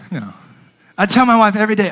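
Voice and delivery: ordinary delivery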